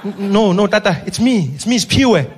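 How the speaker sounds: loud, high-pitched panicking driver; Spiwe. Acted. Ambulance driver correcting the old leader, panicked. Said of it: "He's there panicking hour after hour".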